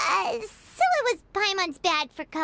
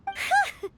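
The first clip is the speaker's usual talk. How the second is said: nervous laugh; 12 semitones higher than usual. Acted. Paimon relieved, laughs nervously.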